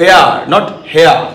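এয়ার said with no h, set against হেয়ার with a clear h; silent h. Shown as a demonstration. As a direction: drop the h in এয়ার, not হেয়ার. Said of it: "উচ্চারণ কি?".

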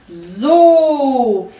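ZU with a long, held U; teacher sounding out a long "zu"; syllable sound demo. Shown as a demonstration. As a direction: buzz the Z, then stretch the U in "zu". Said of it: "a junção do Z com o U".

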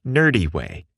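ordinary delivery

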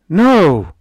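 high, loud frightened no; a scared girl's sharp cry. A narrator's reading. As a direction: blurt it out in sudden fear. Frightened girl refuses.

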